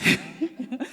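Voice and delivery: short breathy laugh; embarrassed laugh. Real, not acted. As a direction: laugh off the slip.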